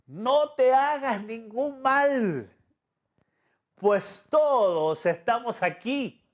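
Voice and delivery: high, slow cry across the prison; clamando a gran voz. Acted. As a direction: call out slowly to stop him. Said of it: "clamó a gran voz". (Pablo clama a gran voz, lento y agudo)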